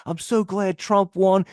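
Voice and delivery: high, slow mock voice; high-pitched slow imitation; angry customer. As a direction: imitate the guy's smug remark. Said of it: "He's like".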